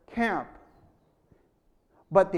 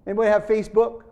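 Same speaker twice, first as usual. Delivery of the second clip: usual speaking voice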